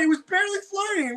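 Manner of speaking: high-pitched exasperated exclamation; hammed-up incredulous protest; mock outrage. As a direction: exclaim it high, in comic disbelief. Mocking her exaggerated snow warning.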